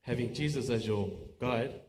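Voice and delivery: ordinary delivery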